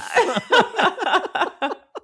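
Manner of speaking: bursting high laugh. Real, not acted. Podcast host cracking up at the book, high laugh. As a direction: let the laugh fly up.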